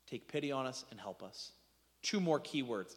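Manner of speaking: ordinary delivery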